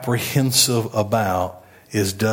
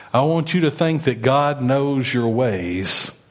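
usual speaking voice

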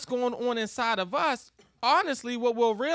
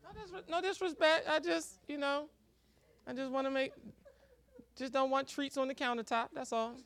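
sheepish mumble; 2 semitones higher, 7 dB quieter than usual. Acted. Voiced sheepish husband.

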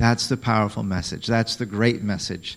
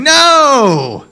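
high, loud incredulous No!; Paul shouting No! at the question; shocked shouting no; 13 semitones higher, 8 dB louder, much slower than usual. Retold or imitated. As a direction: yell it like the idea is insane. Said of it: "the written version of him saying".

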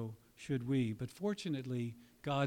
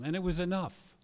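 loud, higher, stressed "was"; emphatic declaration; 6 semitones higher, 7 dB louder than usual. Real, not acted. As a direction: proclaim it, leaning on "was". Emphatic first statement.

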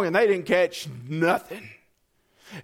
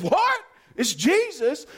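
sharply higher, startled; startled Peter; 6 semitones higher, slower than usual. Acted. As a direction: jump up in disbelief. Peter surprised.

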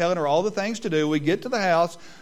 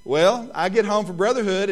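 usual speaking voice

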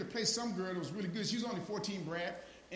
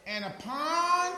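high, loud, slow rising call; soaring preacher cry; 10 semitones higher, 10 dB louder, much slower than usual. Acted. Preacher lifting the congregation to the verse, soaring cry. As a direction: lift the words up like a revelation.

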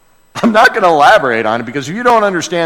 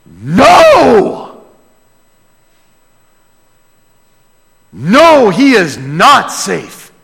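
higher and louder, hammered out; emphatic insistence; 6 semitones higher, 6 dB louder, much slower than usual. Acted. Mister Beaver insists to Susie, emphatic loud. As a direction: stamp every word down.

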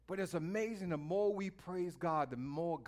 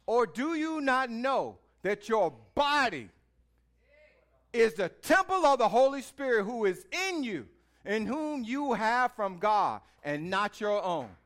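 scripture reading; 6 semitones higher, 8 dB louder than usual. Acted. Reads scripture over music.

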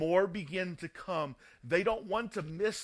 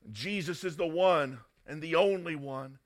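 normal talking voice

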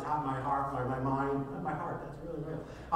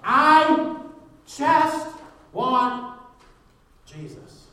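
shouted declaration, hushed ending; 12 semitones higher, 14 dB louder, much slower than usual. Acted. Preacher rejects every other want, shouting then hushed. Climactic repeat of the prayer.

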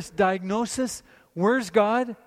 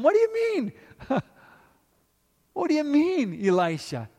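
squeaky, much higher disbelief; incredulous question; 7 semitones higher than usual. Acted. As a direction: ask it like you can't believe him. Mock servant questioning Elisha.

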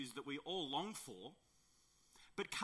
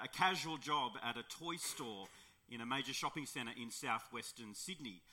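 usual speaking voice

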